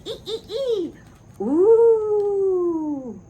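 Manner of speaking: high squealing monkey hoots; giggly monkey ghost noises; monkey wailing. A narrator's reading. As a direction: wail and squeal like excited monkeys. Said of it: "all wailing and jumping around".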